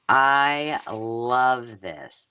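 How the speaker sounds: "I love this" stretched very slow, each word held; slow, moved "I love this"; drawn out. Real, not acted. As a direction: linger on "I love this" as if savouring it. Moved by the gift.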